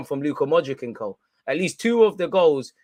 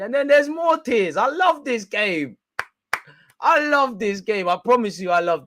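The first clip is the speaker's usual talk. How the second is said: gleeful high glee; 8 semitones higher, 4 dB louder than usual. Acted. Rival fans crying, high gleeful relish. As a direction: relish it like a cartoon villain.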